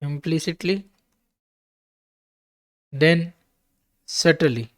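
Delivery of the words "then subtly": ordinary talk, nothing marked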